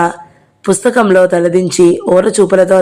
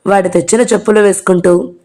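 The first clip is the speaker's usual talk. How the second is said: ordinary delivery, nothing marked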